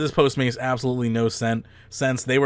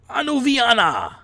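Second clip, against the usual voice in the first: bad Russian accent; 9 semitones higher than usual. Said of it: "a bad Russian accent".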